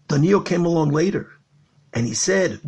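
ordinary delivery

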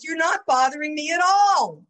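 high, loud, emphatic dismissal of the apology; emphatic reassurance. Retold or imitated. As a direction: insist loudly in the rabbi's voice. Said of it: "And what he meant was".

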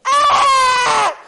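piercing, very high imitated newborn scream; baby's scream. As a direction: scream like a just-slapped baby. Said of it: "the little baby's screaming now".

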